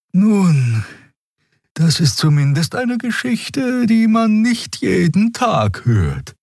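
high, slow, drawn-out alien voice; Xea character voice. A narrator's reading. Xea answers Kai slowly, high and amused.

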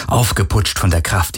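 ordinary delivery, nothing marked

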